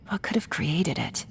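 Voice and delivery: breathless whisper to herself; whispered musing. Acted. Pondering who made this strange domain, whispered to herself. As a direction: wonder it aloud under her breath.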